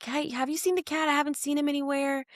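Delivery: a hint of a puzzled partner calling out; boyfriend asking. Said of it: "his boyfriend's like".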